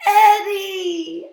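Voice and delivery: high, loud joyful call; loud happy reunion shout; joyful cry. Acted. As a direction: cry out with relief. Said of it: "Al cried".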